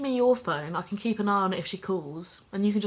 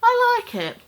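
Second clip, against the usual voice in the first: excited insistence; 13 semitones higher, 7 dB louder than usual. Acted. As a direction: burst up high and loud. Defends wanting the cork board.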